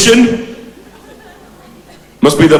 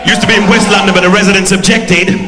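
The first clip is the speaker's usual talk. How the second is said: Brixton geezer character; 4 semitones higher than usual. Acted. Devil Wilkins addressing the audience, in a brash Brixton character voice.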